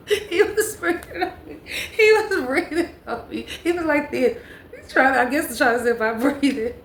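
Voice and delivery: high, helpless laughing while talking; real giggling fit through the sentence. Real, not acted. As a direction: crack up so the words squeal out. Cracking up at her own story.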